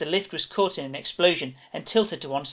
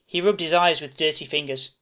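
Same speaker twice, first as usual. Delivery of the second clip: usual speaking voice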